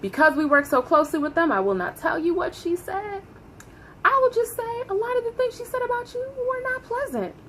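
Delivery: a hint of a discreet prim manager; prim boss voice. Boss quoted, prim and hushed.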